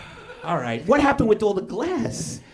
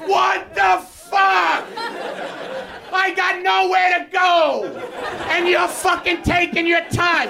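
road rage yell; 6 semitones higher than usual. Retold or imitated. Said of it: "I will be the guy".

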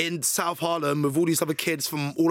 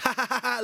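higher-pitched mocking cackle; imitated laughter; 7 semitones higher than usual. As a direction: mimic a gang laughing at you. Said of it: "laughing, laughing".

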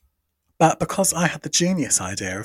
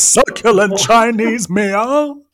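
loud, higher put-on Scouse accent; 10 semitones higher, 9 dB louder than usual. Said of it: "when I'm trying to be [name]".

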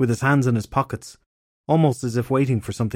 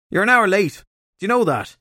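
raised voice, called from upstairs; shouting down the stairs; 9 semitones higher, 3 dB louder than usual. A narrator's reading. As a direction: call it down the staircase, annoyed. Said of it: "Michael shouted down the stairs".

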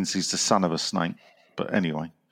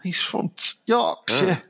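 high-pitched mock Yorkshire accent; dodgy Yorkshire accent; 9 semitones higher, slower than usual. Host teasing a Leeds listener who asked for no accent, dodgy Yorkshire accent. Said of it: "some dodgy Yorkshire accent".

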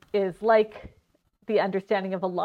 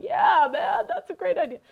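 high laughing dude voice cheering the idea; cartoon rats; 10 semitones higher than usual. Cartoon rats hail the bell plan, high and laughing. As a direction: hype the plan up like a goofy cartoon rat. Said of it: "like, all going".

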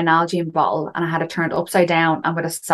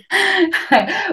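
high laugh; 14 semitones higher than usual. Real, not acted. Laughs at her own remark.

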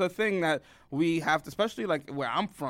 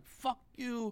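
spazzing out; 8 semitones higher, 8 dB quieter than usual. Retold or imitated. Girlfriend to the guy who catcalled her, imitated high-pitched snap. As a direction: imitate someone snapping at a stranger, voice pitched up. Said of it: "I fucking spazzed out on them".

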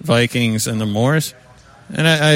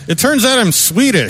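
much higher, chirpy imitation of an ad; mock ad voice; 12 semitones higher, 4 dB louder than usual. Mocking an Ethiopian woman in a DNA ad, high chirpy voice. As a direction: mimic a proud ad customer, pitched up high. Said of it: "and she's like".

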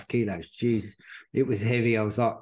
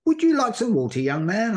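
put-on high, quick, chatty elderly lady; old lady voice; 8 semitones higher, faster than usual. Kindly old lady offers the runner water in a high, fast voice. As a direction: offer water like a doting grandmother, pitched way up. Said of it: "And she goes".

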